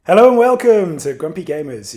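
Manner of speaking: loud, upbeat show opener; host intro. Acted. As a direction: announce the show with energy. Podcast intro announcement.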